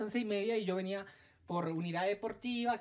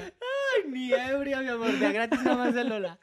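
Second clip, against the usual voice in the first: mock indignant squeal; 4 semitones higher, 6 dB louder, slower than usual. Mock outrage, high squeal.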